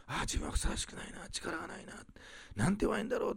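soft, sighed self-doubt; discouraged inner voice. Acted. Preacher voices believers' thoughts of weakness, softly discouraged. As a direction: murmur it as a private, defeated thought.

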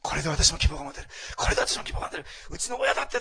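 loud, emotional outburst of hope; imitated tearful joy. Preacher retelling someone weeping with joy over hope, imitated. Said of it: "喜びながら泣いてるんですよね".

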